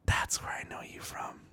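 hushed unvoiced whisper, slow. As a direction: breathe it under your breath, slowly. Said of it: "now I see him and I go".

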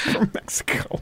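unvoiced, breath-only aside; laughing whisper. Real, not acted. Host finishes his joke after laughing, whispered. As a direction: whisper it through leftover laughter.

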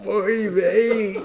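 put-on a laughing old Yiddish man; yiddish accent.

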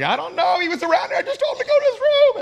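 high, loud, frantic defence; hammed-up flustered shout, pitch climbing; acted panic. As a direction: blurt it out in mock panic. Acts out his panicked reply.

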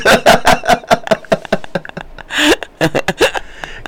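high breathless laughing fit; wheezing laugh. Real, not acted. Laughing at the fall.

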